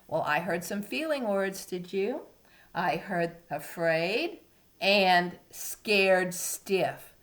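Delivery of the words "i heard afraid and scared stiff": usual speaking voice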